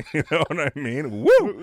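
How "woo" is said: loud high-pitched woo; sudden turned-on holler; excited whoop. Real, not acted. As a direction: whoop like it gets you going. Whoops at accent that excites him.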